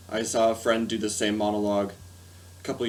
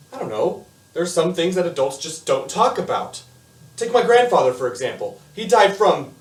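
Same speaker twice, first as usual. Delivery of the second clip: put-on animated, louder monologue delivery of a boy confiding; lively storytelling with punched words and dramatic pauses; Eugene; 6 semitones higher, 7 dB louder than usual. Eugene explains Aunt Blanche's situation to the audience, animated confiding.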